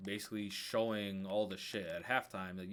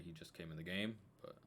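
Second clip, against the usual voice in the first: usual speaking voice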